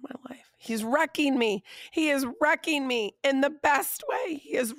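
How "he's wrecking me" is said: normal talking voice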